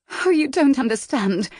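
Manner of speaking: put-on high, upset young woman crying out. A narrator's reading. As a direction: blurt it out in distress. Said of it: "Miss March cried".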